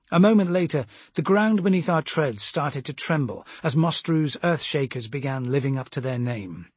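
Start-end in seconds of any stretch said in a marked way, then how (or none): none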